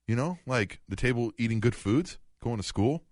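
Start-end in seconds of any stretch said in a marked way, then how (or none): none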